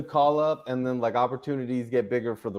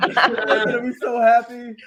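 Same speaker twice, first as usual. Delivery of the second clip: laughing; 9 semitones higher, 3 dB louder than usual. Real, not acted. Teasing a friend who is grinning.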